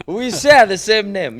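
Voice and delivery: loud high laugh into the words; shouting with laughter at the coincidence; laughing shout of surprise. Real, not acted. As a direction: burst out laughing, delighted. Surprise at shared name.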